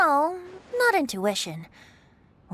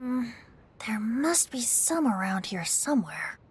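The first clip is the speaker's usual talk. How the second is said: whispered to himself, softer; sneaky whisper; 4 semitones lower, 7 dB quieter than usual. Acted. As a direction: hush it like a secret stash hunt. Sneakily hunting for hidden liquor.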